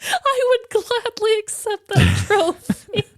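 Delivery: high, slow, grand acceptance; mock acceptance speech. Co-host accepting worst improv award, mock-gracious.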